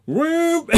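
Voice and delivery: loud, high whoop; high shouted sound effect; imitated drone whoosh. Imitating drone shooting away.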